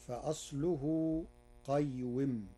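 usual speaking voice

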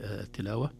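usual speaking voice

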